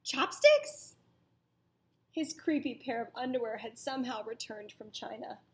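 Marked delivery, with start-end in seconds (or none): 0.0-0.9 surprised. Acted. Reader voices surprised exclamation.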